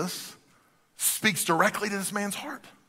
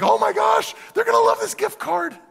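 high, loud squeal of delight; mock gushing; 5 dB louder than usual. Giver imagining a gift card being loved, mock gushing. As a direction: gush in an exaggerated, high-pitched voice.

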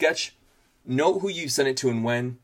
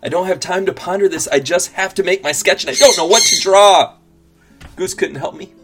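stressed outburst; 5 semitones higher, 10 dB louder than usual. Acted. Stressed self-talk about deadline.

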